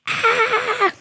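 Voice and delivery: short high-pitched imitation scream; mock scream. Friend hears the dreaded name, imitated high scream. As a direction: let out a high, playful shriek.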